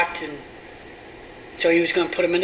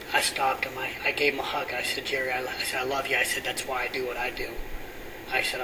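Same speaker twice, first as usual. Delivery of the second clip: softer, lower, near-whispered telling; hushed sermon story; 4 semitones lower, 9 dB quieter than usual. Acted. Pastor retelling his night talk with Jerry, hushed. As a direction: draw the congregation in with a hush.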